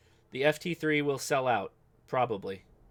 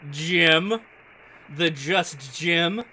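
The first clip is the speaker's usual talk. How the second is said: usual speaking voice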